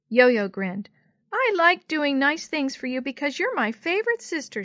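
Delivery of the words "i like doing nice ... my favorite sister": put-on high-pitched proud child; proud little sister. A narrator's reading. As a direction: beam with pride like a small child. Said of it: "she said proudly".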